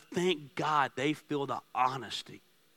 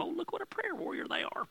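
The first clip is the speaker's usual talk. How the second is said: mocking; 7 dB quieter than usual. Mocking hypocrites' admirers.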